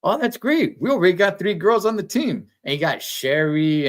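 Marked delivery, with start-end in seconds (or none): 0.0-2.5 much higher, cheery Ned voice; Ned Flanders. Retold or imitated. Ned Flanders cheerfully answers Lisa, high and chirpy. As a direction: beam it out like a chirpy neighbor. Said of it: "then Ned Flanders goes".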